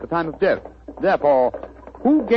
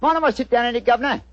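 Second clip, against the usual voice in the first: chirpy cockney delivery pitched up; cockney disguise. Acted. Disguised detective greets the homeowner in cockney. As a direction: play a cheeky London workman. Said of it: "What barbaric jargon do you speak?".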